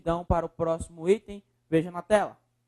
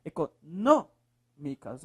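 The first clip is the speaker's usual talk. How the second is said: "Econômicas" slowly, with NÔ set apart and held; stressed syllable; 3 semitones lower, much slower than usual. Shown as a demonstration. Teacher showing the strongest syllable, stress on NÔ in "Econômicas". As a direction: lean on NÔ in "Econômicas". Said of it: "Qual é a sílaba mais forte".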